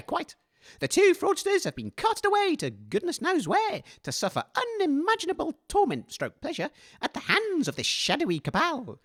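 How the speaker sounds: normal talking voice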